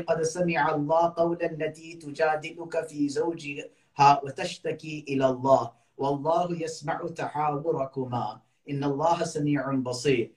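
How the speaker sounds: the Qari recites Surah Mujadala for the gathering in melodic chant; Quran recitation. Acted. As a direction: recite the verses in flowing chant. Qari asked to recite verses.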